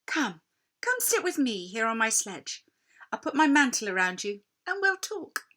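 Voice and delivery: high, coaxing false-kind queen; sudden sweet voice. Acted. Queen lures shivering Edmund onto her sledge, sugary and high. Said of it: "she said in quite a different voice".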